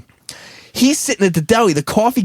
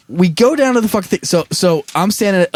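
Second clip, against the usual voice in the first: ordinary delivery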